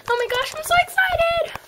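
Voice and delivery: high gushing squeal; excited fan. Acted. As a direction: gush like a starstruck fan. Fan meets Felicity.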